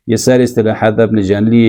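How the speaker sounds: ordinary delivery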